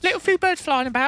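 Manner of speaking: British pigeon voice. Pretend. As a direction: chirp it like a little London pigeon. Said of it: "pigeons have British accents".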